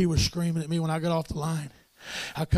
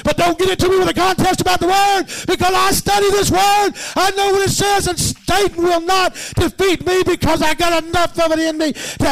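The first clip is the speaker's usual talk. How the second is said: high, loud preacher's shout building; full-force preaching; 11 semitones higher, 7 dB louder than usual. Acted. Preacher rousing the saints, loud and high. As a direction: preach it out with rising fire.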